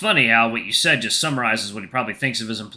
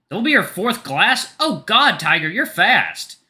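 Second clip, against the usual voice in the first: higher, louder surprised reaction; astonished exclamation; 7 semitones higher, 5 dB louder, slower than usual. Acted. Narrator's character astonished at tiger's fourth glass, surprised exclamation. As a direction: exclaim it, drawing out the last words.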